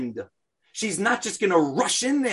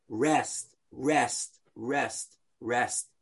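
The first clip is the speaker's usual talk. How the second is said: rest, rest, rest, rest, each softer and lower; soft slow rest; 5 semitones lower, 5 dB quieter, much slower than usual. Shown as a demonstration. Teacher calming the class with soft repeated words. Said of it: "Munach means at rest".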